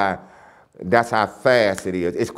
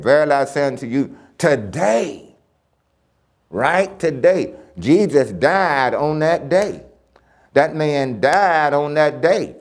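fervent preaching; 5 semitones higher than usual. Acted. Preacher driving home the point to the congregation, fervent preaching.

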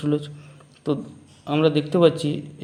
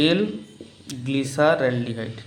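drawn-out dictation; much slower than usual. Shown as a demonstration. Teacher spelling out a compound name.

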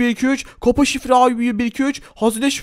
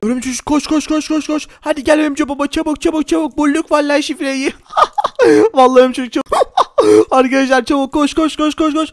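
urgent hurry; 5 semitones higher than usual. Acted. Urging spider to run.

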